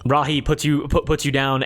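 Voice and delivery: normal talking voice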